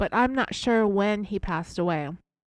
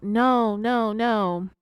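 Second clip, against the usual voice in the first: louder, mock pleading no's; retold crying out; 2 semitones higher, 5 dB louder, much slower than usual. Sherry denying to the investigators, a mild mock cry. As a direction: echo her half-wailed denial lightly. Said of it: "Sherry immediately began crying out".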